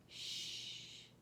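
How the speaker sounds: soft shushing whisper; hushing. A narrator's reading. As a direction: shush a sleeping child. Hushing the sleeping truck.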